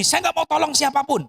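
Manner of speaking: lightly acted stubborn judge, pitched high; mock an obstinate refusal; unjust judge. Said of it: "hakim lalim ini nggak takut Tuhan". (menirukan hakim lalim yang menolak)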